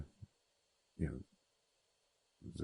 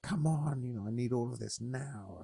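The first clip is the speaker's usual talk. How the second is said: exasperated; 7 semitones higher than usual. Retold or imitated. Mock frustrated phone user.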